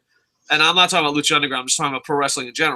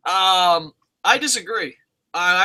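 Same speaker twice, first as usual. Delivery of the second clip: usual speaking voice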